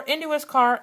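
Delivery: ordinary delivery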